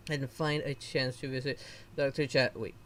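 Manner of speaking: usual speaking voice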